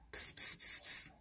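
very soft, voiceless pss-pss; animal-coaxing psst. Real, not acted. Speaker to sleeping animals, soft coaxing pss-pss. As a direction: hiss softly to get the animal's attention.

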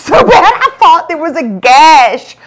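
loud, high comic complaint; exaggerated pain. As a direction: burst out over how much it hurts. Complaining about her hurting head.

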